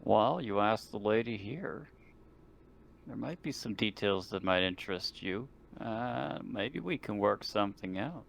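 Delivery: ordinary talk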